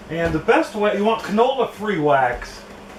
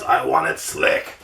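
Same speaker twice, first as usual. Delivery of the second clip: low slow whispery imitation; Norm Abrams impression; 13 semitones lower, slower than usual. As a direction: drop low and breathe it slowly. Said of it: "Like Norm Abrams says".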